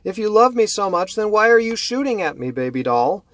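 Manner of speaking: high, loud taunting croon; mocking killer. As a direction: taunt the aunt who shoots. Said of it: "Lindsay laughs a little bit and says".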